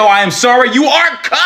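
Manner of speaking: I am sorry rising into a high wailed "cooked"; mock wailing shout. To rapture believers, a mock despairing high wail. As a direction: wail the verdict in hammed-up despair.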